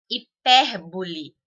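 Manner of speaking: Hipérbole slowly, PÉR punched loud; stress on second syllable. Shown as a demonstration. Teacher giving a proparoxytone example, stress on PÉR in Hipérbole. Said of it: "per é mais forte".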